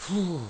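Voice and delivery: loud whew. Acted. Teller blows out breath mid-story.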